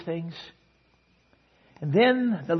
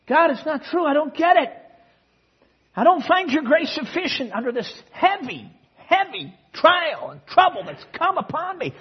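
high, loud, desperate protest; grieving father crying out to God, much higher and louder; anguished cry to God; 10 semitones higher, 4 dB louder than usual. Retold or imitated. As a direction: cry it out to God in raw grief. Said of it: "So he's saying it".

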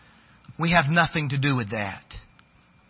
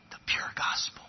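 soft breathy whisper; whispered reverent close; 11 dB quieter than usual. Acted. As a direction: hush it for the climax. Sermon climax dropped to whisper.

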